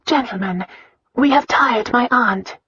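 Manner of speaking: high, measured announcement; polite announcement. A narrator's reading. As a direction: announce it to the gentlemen. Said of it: "Christina announced".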